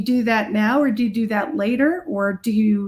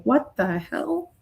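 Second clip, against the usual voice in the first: slow, drawn-out mock disbelief; stretched mock outrage; imitating brother's shock; 2 semitones higher, much slower than usual. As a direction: play the startled big brother. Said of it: "And he was like".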